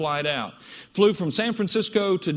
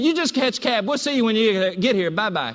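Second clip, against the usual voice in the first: higher, blunt Southern clerk voice; higher Southern woman, matter-of-fact; southern female clerk; 4 semitones higher, 4 dB louder than usual. Retold or imitated. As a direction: flatly tell him there's no shuttle, Southern style. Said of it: "very southern female voice".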